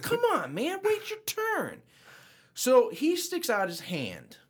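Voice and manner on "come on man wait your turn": annoyed. Retold or imitated. Annoyed at line-cutter, retold.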